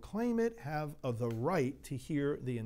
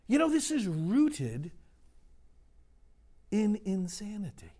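preaching at full force; 5 semitones higher than usual. Acted. Priest preaching hard to his congregation, impassioned. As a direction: drive it home to the congregation, rising.

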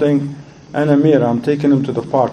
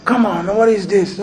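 higher-pitched jeering kid, imitated; mocking teenager; 6 semitones higher than usual. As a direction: imitate a heckling teen in a higher voice. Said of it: "Then one of them said".

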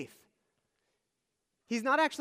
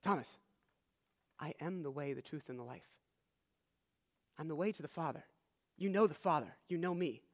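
much softer and lower, gently reassuring a worried friend; soft, tender reassurance to a frightened disciple; 4 semitones lower, 7 dB quieter than usual. Retold or imitated. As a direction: soothe him quietly, almost hushed. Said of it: "And Jesus is reassuring him".

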